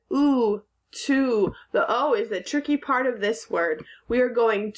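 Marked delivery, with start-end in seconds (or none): 0.1-1.5 to said with a long ooh, stretched; O says ooh. Shown as a demonstration. Teacher showing how the O sounds in "to", drawn-out ooh. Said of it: "the O says".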